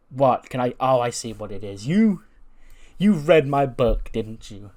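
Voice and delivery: loud, cocky and mocking; loud mocking taunt. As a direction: jeer at her like a smug champ. Becky taunting Lyra backstage.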